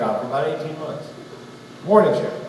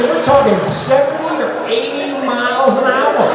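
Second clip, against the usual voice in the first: excited boast; 7 semitones higher, 4 dB louder than usual. Retold or imitated. Retold boast to fishing crowd.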